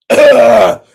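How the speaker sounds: loud disgusted grunt after a cough; a lady's loud grossed-out ugh. Retold or imitated. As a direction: huff it out with disgust. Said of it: "She said".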